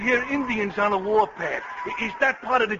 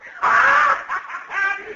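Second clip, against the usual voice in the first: loud laughing whoop; 11 semitones higher, 4 dB louder than usual. Acted. Aide laughs loudly at the colonel in war paint. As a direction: burst into a high loud guffaw.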